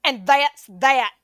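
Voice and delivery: high, slow, emphatic decree; mock stern boss voice; firm final word. Shown as a demonstration. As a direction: lay down the law to the dog.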